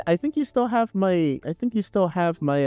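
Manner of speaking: normal talking voice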